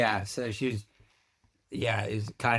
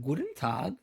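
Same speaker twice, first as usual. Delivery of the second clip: higher-pitched German greeting; German accent demo; 3 semitones higher, 4 dB quieter than usual. Shown as a demonstration. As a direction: show how to start a German accent. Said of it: "You gotta start".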